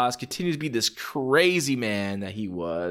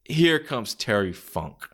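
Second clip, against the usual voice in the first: ordinary talk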